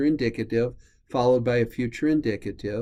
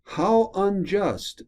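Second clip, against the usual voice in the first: slow, drawn-out ironic "How unjust" pitched high; 7 semitones higher, slower than usual. Acted. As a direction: say "How unjust" with mock outrage, stretched out. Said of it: "almost he says it ironically".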